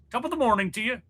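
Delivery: high, lilting stage Irish; mock Irish accent. Host replaying his blunder, high mock Irish accent. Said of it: "That's Irish".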